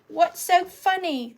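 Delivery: high-pitched English mum; English mum accent. Retold or imitated. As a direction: imitate an English mother questioning her son. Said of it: "They're from England".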